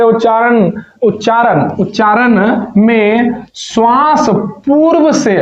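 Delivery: teacher slowly reading out a question; slow careful reading. Real, not acted. Reads exam question out slowly.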